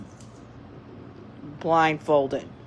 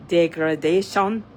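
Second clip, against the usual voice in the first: hammed-up Eastern European accent, done badly; badly imitated Russian accent; 2 semitones higher, 4 dB louder than usual. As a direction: attempt a Russian accent that slips toward Polish. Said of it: "terrible job at imitating it".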